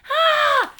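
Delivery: high, loud upset yell. A narrator's reading. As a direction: shriek it out, outraged. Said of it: "she yelled".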